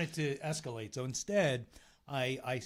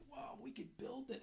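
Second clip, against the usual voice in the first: hushed awe; 9 semitones higher, 13 dB quieter than usual. Retold or imitated. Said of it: "I'm like".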